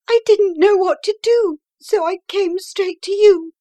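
frightened wife explaining why she came, distraught; distraught plea. A narrator's reading. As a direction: plead as if at your wits' end. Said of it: "Kate pleads with her friend".

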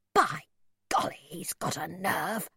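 indignant whisper, breath only; hissed whisper. A narrator's reading. Mother whispers outrage to her son at the pub window. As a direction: hiss it under the breath. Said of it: "my mother hissed".

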